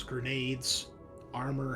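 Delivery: normal talking voice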